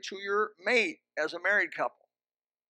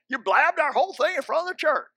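imagined accusation; 8 semitones higher, 7 dB louder than usual. Retold or imitated. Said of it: "and you're saying".

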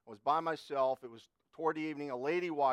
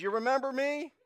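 high, louder question rising expectantly; imitated older lady; 10 semitones higher, 5 dB louder than usual. Older lady greets the clerk who once helped her, imitated high questioning voice. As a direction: voice the customer's eager question, pitched way up.

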